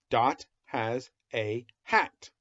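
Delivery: slow, word by word; slow reading aloud. Shown as a demonstration. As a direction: read each word slowly to a child. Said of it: "I'll read it first".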